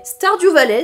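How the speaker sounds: high, loud Stardew Valley; excited proclamation. Real, not acted. Vlogger launching her presentation of the game, excited proclamation.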